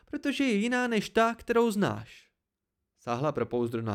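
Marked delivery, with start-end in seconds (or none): 0.1-2.3 high, indignant retort; defensive anger. A narrator's reading. Dena defends her song to the narrator, defensive anger. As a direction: defend your work hotly.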